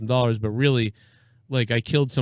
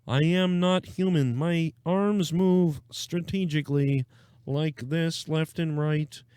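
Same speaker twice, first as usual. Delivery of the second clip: slow, stiff robotic frog voice; mock president as a robotic puppet; Kermit the Frog; 3 semitones higher, slower than usual. Podcaster mocking a president's speech, slow robotic Kermit impression. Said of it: "I talk like, uh, Kermit the Frog in a robot".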